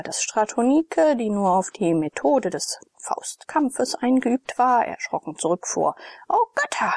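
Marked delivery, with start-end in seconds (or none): 6.3-6.9 much higher, a screeching cry; shrieking woman. A narrator's reading. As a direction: shriek it in shock at the drawn knife. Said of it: "kreischte sie".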